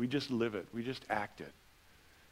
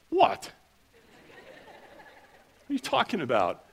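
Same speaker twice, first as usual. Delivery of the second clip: baffled Minnesotan; 7 semitones higher than usual. Retold or imitated. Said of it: "it's like".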